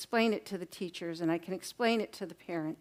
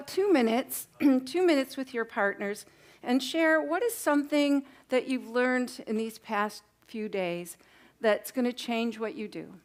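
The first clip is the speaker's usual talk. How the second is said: ordinary talk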